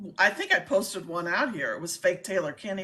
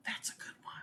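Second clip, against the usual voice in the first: whispering; 18 dB quieter than usual. Real, not acted. Aside after the question.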